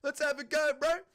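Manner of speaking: cheery mock Kiwi accent; Kiwi bloke. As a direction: play an eager Maori guy keen for a scrap. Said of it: "two grand, you wanna jump into the octagon?".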